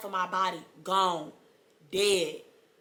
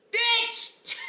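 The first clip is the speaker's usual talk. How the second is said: shrieking laugh. Real, not acted. Telling viewers about the epidural, a shrieking laugh. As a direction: burst into a screaming laugh.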